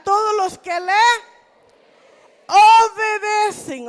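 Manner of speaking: slow, loud congregational reading; loud group reading of scripture; read aloud together. Shown as a demonstration. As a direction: lead the whole church through it slowly and loudly. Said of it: "tenemos que leerlo en voz alta".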